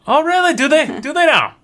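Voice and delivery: very high, questioning woman character; curious dockside woman. Acted. Dock woman asking about bearded dwarves, high curious voice. Game master plays curious Astrid.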